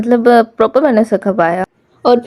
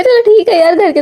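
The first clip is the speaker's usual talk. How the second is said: sarcastic high permission; 10 semitones higher, 3 dB louder than usual. Acted. Mock-permits the cat to go inside.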